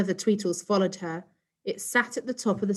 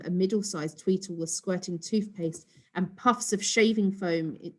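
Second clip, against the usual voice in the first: plain reading voice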